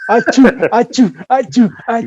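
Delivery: hammed-up sneezing; fake sneezes. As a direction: sneeze it out three times, big and fake. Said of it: "I'll be like".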